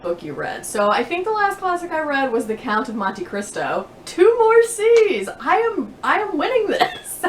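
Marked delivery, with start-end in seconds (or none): 4.0-7.2 high, slow gloating over a little win; playful triumph. Real, not acted. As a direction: crow about winning, half joking. Jokes about winning the tag.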